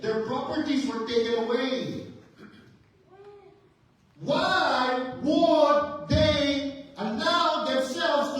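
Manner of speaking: ringing, insistent sermon delivery; preaching at full force. Real, not acted. Preacher builds to climax.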